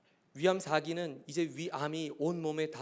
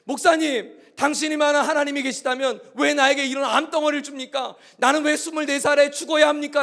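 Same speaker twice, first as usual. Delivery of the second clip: loud, higher pleading outcry; a dying young man shouting why at his pastor; imagined wailing cry; 8 semitones higher, 13 dB louder than usual. Pretend. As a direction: cry out at God through the pastor. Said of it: "라고 울부짖는다면".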